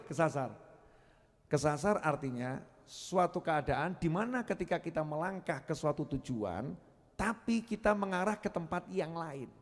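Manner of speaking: usual speaking voice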